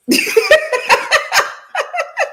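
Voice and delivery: high, rolling peal of giggles; long laugh. Real, not acted. Wife thanking husband after pronunciation teasing, long high laugh. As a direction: giggle high and keep it going.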